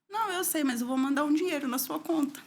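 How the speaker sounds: sister's reply. Retold or imitated. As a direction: voice the caring sister insisting. Sister's caring reply retold. (irmã insistindo, voz bem mais aguda)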